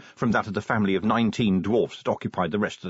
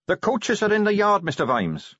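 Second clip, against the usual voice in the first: put-on higher eager young Carrot voice; 10 semitones higher than usual.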